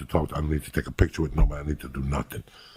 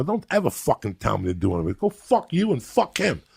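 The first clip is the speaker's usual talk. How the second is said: angry retort; 8 semitones higher than usual. Retold or imitated. Acts out his angry retort.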